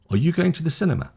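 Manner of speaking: high question tune on "Are you going to the cinema"; rising question tune. Shown as a demonstration. Teacher models a question for intonation learners, rising question tune. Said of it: "Up. It's a question".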